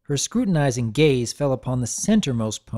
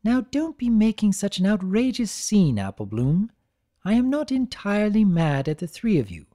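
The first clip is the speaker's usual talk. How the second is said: soothing refined lady voice; 7 semitones higher than usual. Acted. Rarity soothing.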